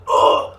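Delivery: loud, high mock gasp of outrage; shocked "oh". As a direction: cry out in exaggerated disbelief. Acted shocked reaction to "Who?.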